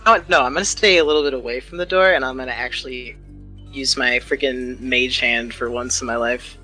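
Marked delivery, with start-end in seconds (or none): none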